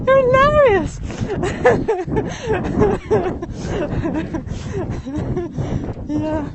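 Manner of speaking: high, loud laughing exclamation; real delighted laughter. Real, not acted. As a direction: burst out with it mid-laugh. Laughing at a viewer's joke.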